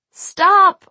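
loud, high-pitched shriek; sudden piercing cry. A narrator's reading. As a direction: shriek it in pain and panic. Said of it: "I shrieked".

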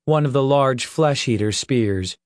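plain reading voice

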